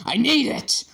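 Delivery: frantic teacher demanding the stone; desperate demand. A narrator's reading. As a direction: snap it out like a madman. Teacher manhandling pupil.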